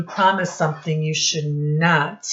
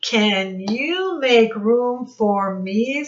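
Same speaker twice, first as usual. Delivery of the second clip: higher, slow, changed voice for a calf; slow, higher calf voice for the children; calf asking; 5 semitones higher, slower than usual. Acted. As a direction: ask sweetly as a little calf. Calf asks in read story.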